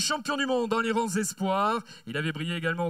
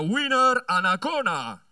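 a slow, drawn-out rider name for the crowd; announcer name call; 4 dB louder than usual. Real, not acted. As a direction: present the name to the stadium.

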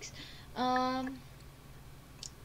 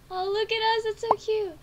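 delighted gush; 9 semitones higher, 6 dB louder than usual. Real, not acted. Gushing at a couple photo.

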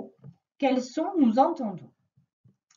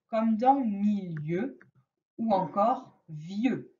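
1 slow examples; slower than usual. Shown as a demonstration. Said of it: "je vois I E U".

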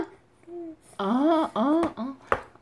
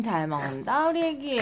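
usual speaking voice

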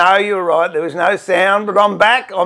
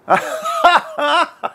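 high-pitched real laugh; real laugh, higher than the speaking voice; amused laugh; 9 semitones higher than usual. Real, not acted. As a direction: let out a high laugh at the chat. Amused while reading viewer chat.

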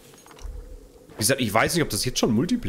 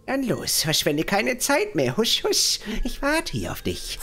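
NPC voice; 6 semitones higher, slower than usual. Acted. Reads NPC dialogue aloud.

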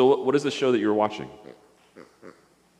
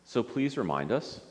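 lower, soft prayer lines for others to repeat; 4 semitones lower, 7 dB quieter, slower than usual. Acted. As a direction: lead a gentle prayer phrase by phrase. Leads prayer for repetition.

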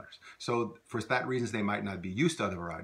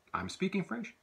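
higher, as a question prompt; 9 semitones higher, 4 dB quieter than usual. Shown as a demonstration. Teacher prompts students' translation, higher. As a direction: lift it like a cue. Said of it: "prompting learner answer".

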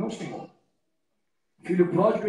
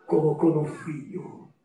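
ordinary delivery

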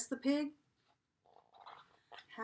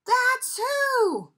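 excited shout; 12 semitones higher, 10 dB louder than usual. Acted. Big reveal in picture book.

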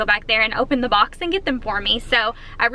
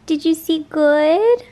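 higher, louder, slow coo; 4 semitones higher, 4 dB louder, slower than usual. Real, not acted. Owner asking her hamster, higher cooing baby talk. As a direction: coo the question warmly.

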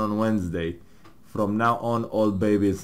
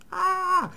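high yelp. Real, not acted. Sudden outburst.

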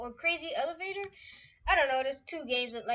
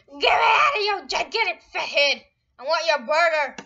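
loud shouted demand; exasperated yell; 8 dB louder than usual. Real, not acted. Player demanding out of a giant head, loud yell. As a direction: holler it at the character. Yelling at big-headed game character.

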